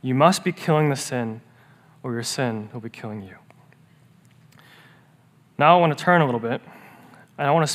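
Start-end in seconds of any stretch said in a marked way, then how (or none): 2.0-3.4 hushed warning whisper; breathy sermon whisper. Real, not acted. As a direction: drop to a whisper for the threat.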